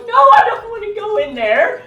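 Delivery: high, loud whining protest; hammed-up frightened whine; whiny refusal. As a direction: refuse like a scared kid. Refusing to enter the garage.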